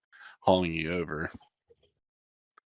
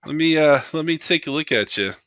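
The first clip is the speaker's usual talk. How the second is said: higher, louder, friendly check-up voice; medic crewman; 6 semitones higher, 4 dB louder than usual. Acted. Crewman scans the pulled-in pilot, higher friendly medic voice. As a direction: patter like a casual field medic. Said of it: "and just like".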